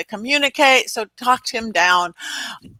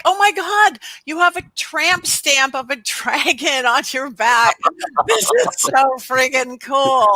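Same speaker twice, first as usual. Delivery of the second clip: excited; 3 semitones higher than usual. Retold or imitated. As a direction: marvel at the dragon scar. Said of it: "so it's like".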